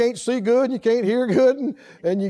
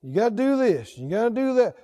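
low-energy imitation of a reluctant churchgoer; put-upon mock grumbling; mock weariness; 3 semitones lower than usual. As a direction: drone through a list of chores, weary. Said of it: "what a weariness it is".

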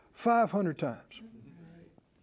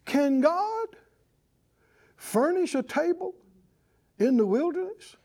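higher, questioning mock challenge; challenging attitude; 6 semitones higher than usual. Preacher quoting unthankful Israelites doubting God, challenging attitude. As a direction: imitate a doubter's challenge, pitched up and rising. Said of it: "Listen to what it sounded like".

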